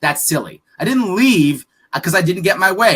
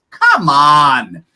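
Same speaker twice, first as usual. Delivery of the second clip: louder, exasperated come on; scoffing dismissal; 6 semitones lower, 5 dB louder than usual. Real, not acted. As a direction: brush the idea off with disbelief. Scoffing at the hurt-feelings idea.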